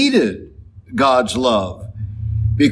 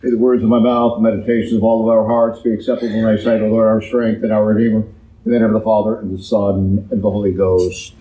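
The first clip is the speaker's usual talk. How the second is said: quick, murmured liturgical prayer; opening prayer; 2 semitones lower, much faster than usual. Acted. Priest praying before his sermon, quick and rote. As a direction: rattle off a familiar prayer before preaching.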